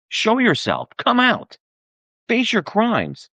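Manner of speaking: ordinary talk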